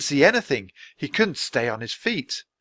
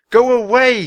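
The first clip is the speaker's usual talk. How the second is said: high, loud shout; boy yelling at a wolf; 11 semitones higher, 9 dB louder than usual. A narrator's reading. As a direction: scare the wolf off with a yell. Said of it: "he shouted".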